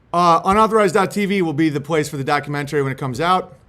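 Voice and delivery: normal talking voice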